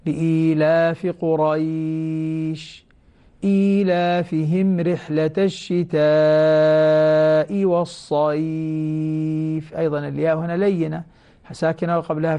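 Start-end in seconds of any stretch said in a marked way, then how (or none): 0.1-9.7 slow tajweed chant, long vowels held; Quranic recitation. Shown as a demonstration. Teacher reciting a verse for students, slow drawn-out chant. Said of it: "لاحظوا".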